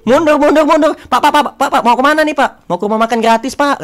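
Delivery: high, loud shouts guiding a truck back; retold truck crew shouting for attention; calling out. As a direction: holler urgently to someone across the yard. Acts truck crew calling out directions.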